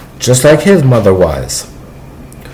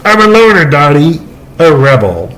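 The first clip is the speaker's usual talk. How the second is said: Pee Wee Herman impression; 2 semitones higher than usual. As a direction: squeak it out like Pee Wee, laughing at the end. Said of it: "let me see if I can do it".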